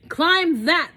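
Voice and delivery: a hint of a bug giving orders; bossy bug order. A narrator's reading. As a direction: bug ordering Horton up the mountain, lightly bossy. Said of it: "the bug said".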